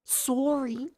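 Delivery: lightly imitated Canadian accent; Steve's Canadian accent. Streamer mocking Steve apologising to Claire, hint of Canadian accent. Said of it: "He has a Canadian accent".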